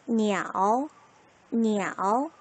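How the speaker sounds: 니아오 in a dipping third tone; Mandarin third tone. Shown as a demonstration. Demonstrating for pupils, 니아오 in third tone. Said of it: "삼 성".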